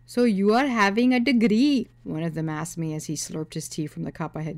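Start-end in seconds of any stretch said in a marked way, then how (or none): 0.1-1.9 put-on high, loud Indian-accented suitor; nosy Indian accent, pitched high. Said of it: "ones with thick accents and head bobbing".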